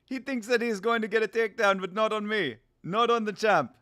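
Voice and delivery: put-on South African fighter voice; dismissive champ, South African accent. Streamer as champ scoffing at the challenger, South African accent. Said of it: "I like that South African accent".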